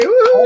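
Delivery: high, excited "woo"; cheering whoop. Real, not acted. Host welcoming the last guest, high cheering whoop.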